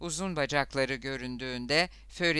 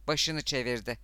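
ordinary delivery

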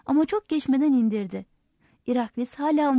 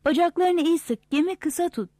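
high, urgent call; raised, higher narrated shout; shouted instruction; 5 semitones higher than usual. A narrator's reading. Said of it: "diye bağırdı".

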